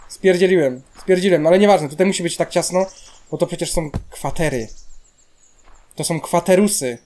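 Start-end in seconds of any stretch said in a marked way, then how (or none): none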